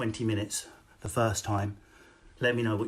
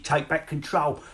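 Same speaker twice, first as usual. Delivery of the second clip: ordinary delivery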